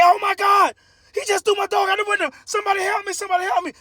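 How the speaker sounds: shrill high panicked lady screaming for help; high-pitched hysterical woman's scream. Acted. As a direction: scream it like a frantic woman whose dog was thrown. Lady screams as dog thrown.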